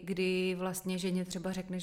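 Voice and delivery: usual speaking voice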